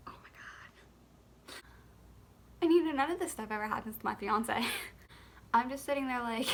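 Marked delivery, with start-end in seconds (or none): 0.1-1.5 slow, breathless whisper of shock; stunned whisper. Real, not acted. Mom covered in her baby's vomit, stunned slow whisper. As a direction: barely get the words out, frozen in disbelief.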